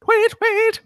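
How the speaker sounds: high, eerie disembodied chirping; unearthly voice. Acted. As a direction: chirp like a ghostly bird from nowhere. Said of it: "So disconcerting".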